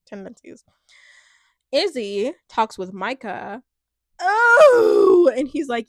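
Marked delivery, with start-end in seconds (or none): 4.2-5.3 loud, high shout of shock. Real, not acted. Viewer reacting to the show, shouting in shock. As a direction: blurt it out in real surprise.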